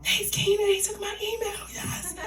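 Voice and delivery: high-pitched re-enacted thrill; giddy excitement. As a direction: squeal it like a starstruck fan. Thrilled Kenan took the email.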